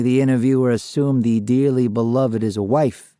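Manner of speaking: usual speaking voice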